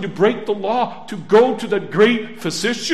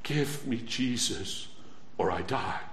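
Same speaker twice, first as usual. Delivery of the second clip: hushed desperate plea; 10 semitones lower, 12 dB quieter than usual. Acted. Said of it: "What's in those hearts?".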